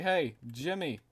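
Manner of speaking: calling out. Pretend. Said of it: "as soon as you just say".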